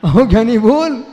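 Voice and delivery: loud call to the listener. Real, not acted. Preacher loudly urging a listener to answer. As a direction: call him out, loud and teasing.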